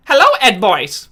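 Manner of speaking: high, loud cartoon voice; Ralph impression. Streamer shows chat his Ralph impression, high and loud. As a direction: greet the boys as the cartoon villain. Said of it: "can I do Ralph from Ed, Edd n Eddy".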